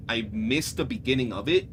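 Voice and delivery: ordinary talk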